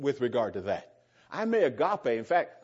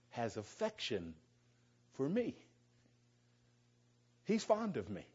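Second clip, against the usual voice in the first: soft tender; 9 dB quieter than usual. Real, not acted. Tender personal remark about God.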